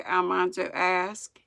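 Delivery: ordinary delivery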